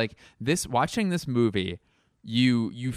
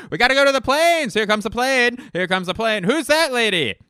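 very high, loud naval yell; hammed-up captain screaming an order; frantic movie impression; 13 semitones higher, 8 dB louder than usual. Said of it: "here's my impression of".

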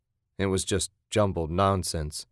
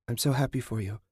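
higher-pitched, tender gushing; gushing mother; 4 semitones higher, 4 dB quieter, faster than usual. A narrator's reading. Mother to bride before the aisle, gushing. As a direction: gush softly with motherly pride. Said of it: "her mother had gushed".

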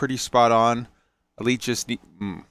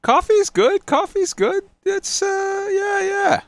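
squeaky high voice; slower than usual. Pretend. High silly repeated voice.

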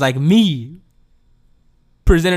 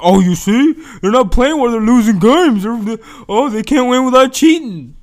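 higher, louder jeering voice of a critic; taunting fan imitation, pitched up and loud; mocking hater; 6 semitones higher, 6 dB louder than usual. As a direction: sneer it like a gloating rival fan.

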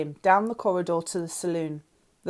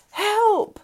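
much higher, loud shriek; 7 dB louder than usual. A narrator's reading. Lauren, trapped below deck, shrieks for help. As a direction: scream it for help in panic. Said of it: "I shrieked".

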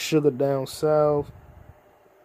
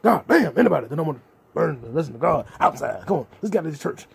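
preacher impression; faster than usual. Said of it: "turned into the preacher".

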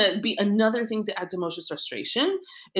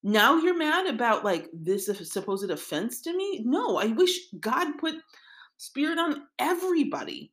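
higher-pitched incredulous pushback; exasperated retort; 8 semitones higher than usual. Retold or imitated. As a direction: Moshe scoffing at his assistant. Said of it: "responding in force to Yehoshua".

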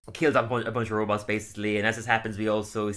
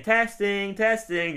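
megaphone voice; 9 semitones higher, much slower than usual. Retold or imitated. Megaphone test call.

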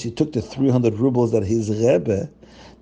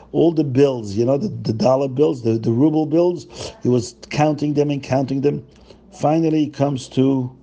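ordinary delivery, nothing marked

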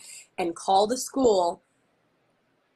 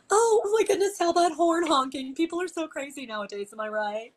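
much higher, loud, mock-oblivious chirp; feigned innocence; 9 semitones higher, 3 dB louder than usual. Shown as a demonstration. Parent holding up the drop-off line, feigning oblivious innocence. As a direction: play dumb, overly sweet. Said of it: "act like you don't even know".

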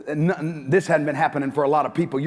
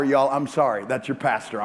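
ordinary talk

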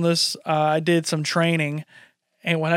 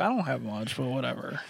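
much lower and softer, shrugging it off; downbeat mumble; 6 semitones lower, 12 dB quieter than usual. Retold or imitated. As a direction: trail off, not bothered. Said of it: "I was like".

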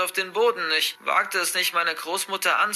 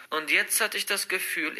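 plain reading voice

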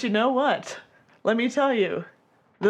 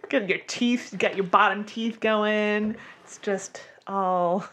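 usual speaking voice

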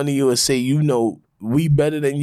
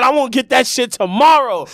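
higher and louder, full of swagger; confident; 9 semitones higher, 5 dB louder than usual. Shown as a demonstration. Coaching a friend to sound sure, loud and high. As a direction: say it like it's already done. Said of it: "That's how you gotta sound".

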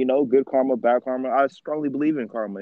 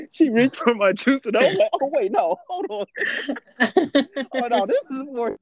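high and laughing through the words; laughing while telling; 9 semitones higher than usual. Real, not acted. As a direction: giggle your way through the story.

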